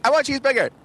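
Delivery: put-on very high, childish demand; little brother demanding a cheeseburger; little kid voice. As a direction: pipe it up like a small boy. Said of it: "And Kevin's like".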